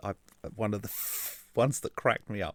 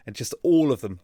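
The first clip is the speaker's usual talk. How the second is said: usual speaking voice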